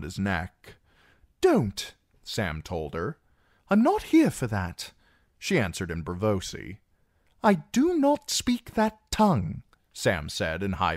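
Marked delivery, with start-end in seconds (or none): 1.4-1.9 higher, anxious protest; a timid young man says no; Sam's voice. Acted. Sam pushes woman away. 3.7-4.9 higher, calm woman's reply. Acted. Woman answers in Braavosi. 7.4-9.6 put-on higher, stiff young man; Sam's voice. Sam answers in Valyrian.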